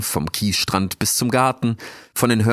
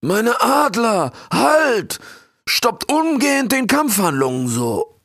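high, loud commanding king voice; booming warrior king; 14 semitones higher, 4 dB louder, slower than usual. Acted. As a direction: call your eagles to halt with authority. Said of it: "rief der König".

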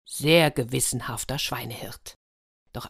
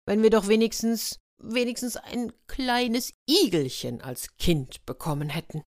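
wistful wife; 6 semitones higher than usual. Acted. Wife wishing for a child.

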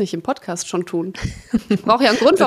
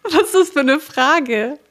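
baffled by the question; incredulous; 5 dB louder than usual. Real, not acted. (ungläubig)

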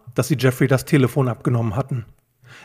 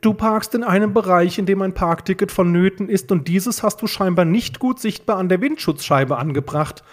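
higher-pitched, know-it-all lecture; precise, matter-of-fact reasoning; explaining; 6 semitones higher than usual. A narrator's reading. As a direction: explain it pedantically to a friend. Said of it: "erläuterte Justus".

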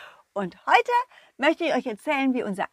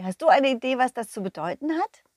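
plain reading voice

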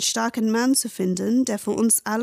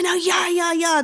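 put-on eager child; 8 semitones higher than usual. Mimics excited children.

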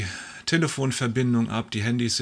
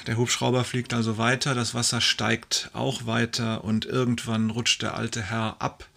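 usual speaking voice

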